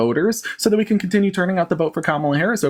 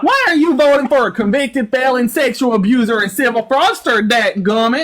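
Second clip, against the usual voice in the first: twangy country bumpkin put-on; exaggerated Southern host voice; hillbilly interrogator; 9 semitones higher, 4 dB louder, slower than usual. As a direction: ask it as an exasperated yokel. Mock hillbilly host questions.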